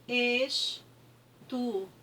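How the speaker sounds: "Estudante" very slowly, es... tu... dan... te; long gaps between the four syllables of "Estudante"; separated syllables. Shown as a demonstration. Teacher separating the word for pupils as they write, syllable by syllable. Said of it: "é a forma como você vai separar".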